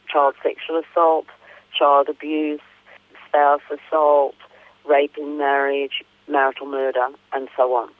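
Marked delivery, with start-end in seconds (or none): none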